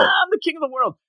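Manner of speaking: shouted high and loud, arms-wide triumph; hammed-up king of the world cry; triumphant movie quote. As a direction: crow it from the ship's bow, high and loud. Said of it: "Leonardo DiCaprio chainsaw guy".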